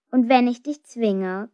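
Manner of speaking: usual speaking voice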